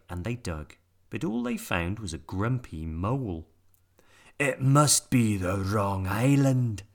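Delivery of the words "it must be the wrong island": slow, louder pirate grumble; grumbling pirates. A narrator's reading. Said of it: "they said".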